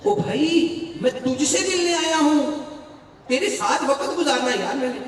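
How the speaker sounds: high, loud, pleading call to a friend; a guest calling out to his friend, loud and high. Acted. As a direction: plead with your host at full voice. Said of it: "اس نے کہا".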